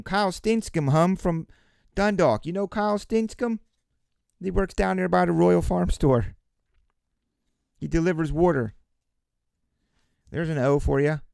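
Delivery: put-on teasing Baltimore local accent. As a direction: play a chatty Baltimore local. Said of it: "It's a Baltimore joke".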